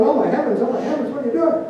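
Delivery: high, startled yelp of someone burned; mock alarmed cry. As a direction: cry out in alarm as hot coals land on your head. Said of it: "the person's going".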